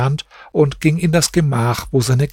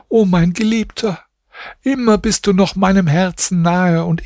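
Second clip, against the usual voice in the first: higher, mournful recited lament; lamenting verses; 6 semitones higher than usual. A narrator's reading. Grieving wife laments her lost husband in verse, higher mournful voice. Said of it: "Dann sprach sie folgende Verse".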